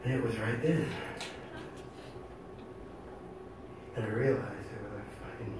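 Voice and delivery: very soft, low, humbled confession; hushed realization. Real, not acted. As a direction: let it sink in quietly, with long pauses. Sober realization after the tirade.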